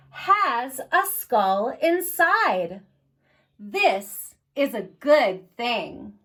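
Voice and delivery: slow, gentle read-aloud pace; reading to children. Acted. Librarian reading Skulls to children, slow read-aloud. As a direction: read it slowly to little ones at story time. Reads the picture book aloud.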